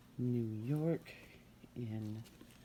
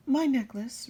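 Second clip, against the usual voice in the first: put-on higher, louder girl's reply; 10 semitones higher, 10 dB louder than usual. Girl offering her necklace, higher and louder. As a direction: answer eagerly in a girl's voice. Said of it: "replied the girl".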